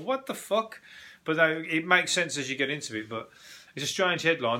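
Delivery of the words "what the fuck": high-pitched retold incredulity; a higher, mock-bewildered exclamation; baffled disbelief. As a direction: imitate your own baffled reaction, pitched high. Retells reaction to odd headline.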